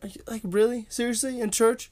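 high-pitched mock disbelief; incredulous. Retold disbelief at friend's prank.

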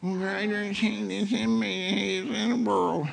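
muffled, mumbled half-hearted verse; half-hearted recitation. Shown as a demonstration. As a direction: run the words together without conviction. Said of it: "won't say it like that anymore".

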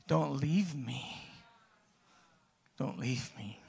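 soft pleading, slowing down. Retold or imitated. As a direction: beg quietly, almost breaking. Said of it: "and I was like".

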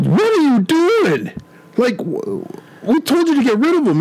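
high, incredulous "what are you doing"; mock appalled henchman. A henchman scolds his trigger-happy comrade, high and appalled. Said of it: "the one guy goes".

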